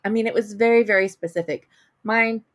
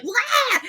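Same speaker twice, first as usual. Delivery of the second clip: laughing high-pitched mock wail; a playful baby cry, much higher. Venting frustration after tacos, high laughing mock wail. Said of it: "I was just like".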